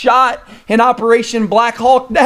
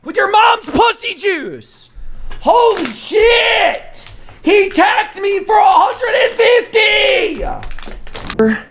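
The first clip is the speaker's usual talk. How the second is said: hyped shout; 11 semitones higher than usual. Acted. Streamer yelling trash talk at his friend, hammed-up shout. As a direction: scream it out like a hype streamer.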